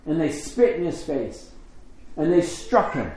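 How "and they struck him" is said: ordinary delivery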